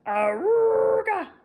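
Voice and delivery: slow, high, loud horn call; loud honk call. Acted. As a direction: belt it out like a horn blast. Harry honks the horn.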